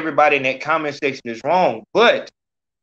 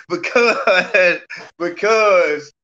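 laughing; 3 semitones higher, much slower than usual. Real, not acted. Host laughing through his words.

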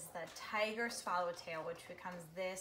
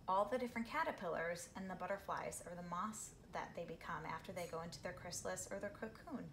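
ordinary delivery

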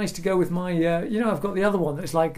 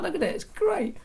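excited; 11 semitones higher than usual. Real, not acted. Showing off the new hat.

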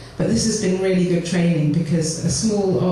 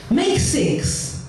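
much higher, playful command; high closing line; 11 semitones higher, much slower than usual. Acted. Granny urges the children to play in a high voice. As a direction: lift up to a light high call to play.

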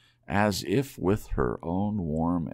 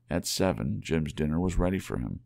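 usual speaking voice

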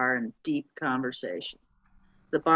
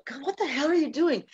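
exasperated bartender; 10 semitones higher than usual. Acted. Bartender reacts to fairies' odd arrival.

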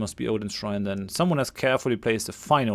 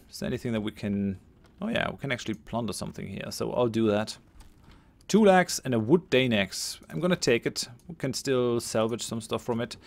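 usual speaking voice

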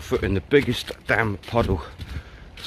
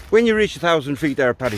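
higher-pitched, hammed-up Irish voice; terrible Irish accent; 3 semitones higher than usual. Murphy the instructor briefing Paddy in the plane, bad Irish accent. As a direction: put on a bad Irish brogue. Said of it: "apologies for the terrible accent".

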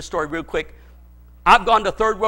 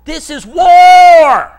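slow, loud and high war cry; proclaiming shout; 10 semitones higher, 15 dB louder, much slower than usual. Acted. Pastor roaring at the congregation, loud shout. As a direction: roar it at the congregation.

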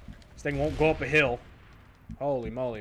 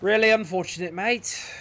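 higher-pitched put-on British voice; mock British accent; 4 semitones higher than usual. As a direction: slip into a playful British accent. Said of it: "this fucking British voice shit again".

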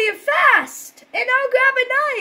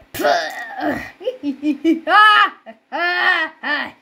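doll cackle. Pretend. Evil doll laughs.

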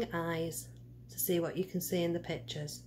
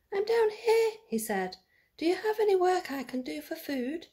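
much higher, small voice calling upward; 10 semitones higher than usual. A narrator's reading. Jack calling up to the giant woman, high and small. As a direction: call up from far below, small and high.

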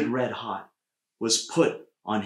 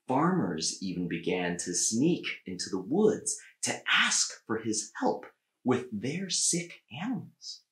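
usual speaking voice

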